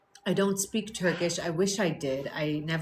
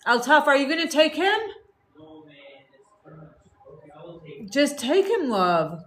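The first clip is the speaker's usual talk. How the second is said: louder, higher call across the house; 9 semitones higher, 3 dB louder than usual. Real, not acted. Mother calling to her husband about taking the boy. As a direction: call out to someone in another room.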